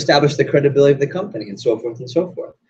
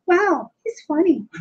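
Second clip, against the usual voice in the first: very high, drawn-out mock girly voice; hammed-up swooning falsetto; impressed young lady; slower than usual.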